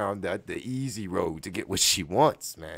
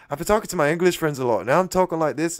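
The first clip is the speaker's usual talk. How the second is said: higher, louder, lightly English accent; slight English accent; 5 semitones higher, 5 dB louder, faster than usual. Real, not acted. Explaining his English friends to listeners, slight English accent. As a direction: slip into a bit of an English accent. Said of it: "doing a little bit English accent".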